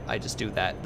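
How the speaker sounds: ordinary talk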